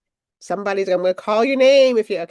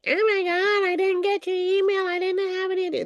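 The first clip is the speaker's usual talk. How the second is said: put-on much higher, airy valley-girl attendee; bubbly attendee impression; 10 semitones higher than usual. Host mocking confused attendees who missed the email, high and bubbly. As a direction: play an over-the-top ditzy participant. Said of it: "that's my impersonation of anybody".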